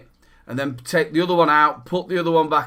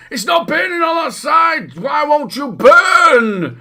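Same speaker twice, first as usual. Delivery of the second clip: high, loud exasperated wail; mock-furious shout with drawn-out final words; frustrated rant; 11 semitones higher, 7 dB louder, slower than usual. Builder raging at coils that won't burn, hammed-up frustrated shout. Acts out old coil-building rage.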